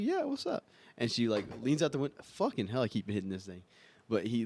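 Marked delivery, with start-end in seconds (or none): 0.0-0.6 high, bright cashier voice; high girl voice. Retold or imitated. Cashier answers dad, high girly voice. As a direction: lift it up like a cheery cashier. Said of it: "she's like".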